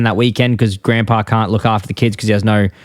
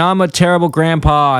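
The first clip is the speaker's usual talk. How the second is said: higher put-on wail; mock crying; 6 semitones higher than usual. Grandpa confesses to the kids, mock crying. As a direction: imitate a cartoon grandpa's sob. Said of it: "Then Grandpa starts crying".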